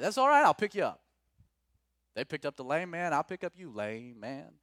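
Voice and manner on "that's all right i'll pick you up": loud, high, cheerful offer to drive; eager reply. Pretend. Pastor urging church to give rides, loud eager. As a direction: belt out the offer to pick him up. Answering friend, acted.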